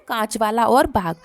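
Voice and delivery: normal talking voice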